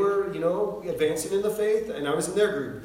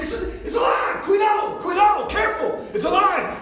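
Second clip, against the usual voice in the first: high, loud imitated warning cries; yelling; 13 semitones higher, 7 dB louder than usual. Preacher jumping back from the bag, frantic high yelling. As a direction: shout frantic warnings to onlookers. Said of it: "he's, he's yelling".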